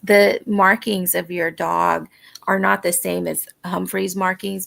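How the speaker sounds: ordinary delivery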